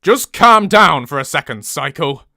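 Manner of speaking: loud growled order, dropping at the end; forceful, falling command. A narrator's reading. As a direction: growl it as a northern character. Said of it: "he growls".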